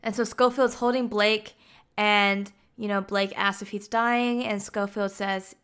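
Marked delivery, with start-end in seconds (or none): none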